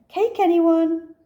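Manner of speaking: high, loud, slow offer; change the subject brightly; dodging a confusing topic. Acted.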